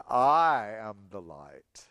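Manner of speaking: low, slow, weighty declaration as Jesus; solemn proclamation. Acted. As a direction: let the words land with authority.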